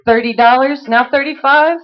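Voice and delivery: rapid rhythmic bid chant; auctioneer. Acted. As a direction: rattle off bids like an auctioneer.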